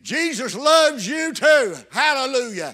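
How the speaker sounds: loud, high, stretched-out proclamation; exultant preaching shout. Acted. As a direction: proclaim it joyfully to the crying prisoner. Preacher answers the imagined prisoner.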